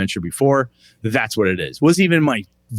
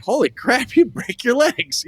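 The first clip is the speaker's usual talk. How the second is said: mock alarm; 13 semitones higher, slower than usual. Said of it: "they were like".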